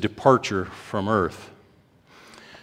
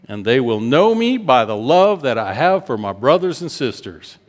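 raised, slowing scripture reading; preaching scripture; 5 semitones higher, 3 dB louder than usual. Acted. Preacher proclaiming Jesus's words to the congregation, raised.

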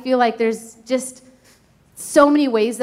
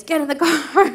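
mock yell; 6 semitones higher than usual. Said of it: "yelling at my kids".

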